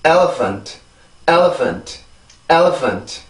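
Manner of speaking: "elephant" in English rhythm, falling away after "el"; pronunciation drill. Shown as a demonstration. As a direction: model "elephant" with stress on the first syllable. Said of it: "한 번 더".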